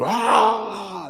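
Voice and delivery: sharp high yell of rage; high retold battle scream. Boy charging the bully with a high yell. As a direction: let out a wild war cry. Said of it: "I was screaming".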